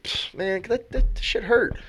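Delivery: imitating the player whining about his foot; hurt player's complaint. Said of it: "he's like".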